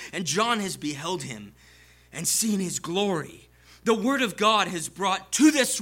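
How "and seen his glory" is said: ordinary delivery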